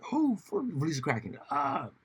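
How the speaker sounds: high, slow mocking quote; hammed-up voice of his old self; mock old [name]. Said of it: "would have been like".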